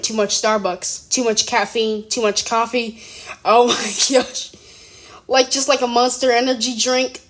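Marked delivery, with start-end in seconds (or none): 3.4-4.5 laughing. Real, not acted. Laughing at bouncing states.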